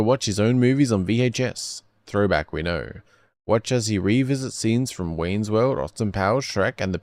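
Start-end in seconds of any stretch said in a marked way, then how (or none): none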